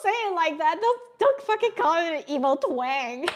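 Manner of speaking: high, loud protest; mock indignation. Real, not acted. Streamer scolds chat over calling it emo twang, mock indignant.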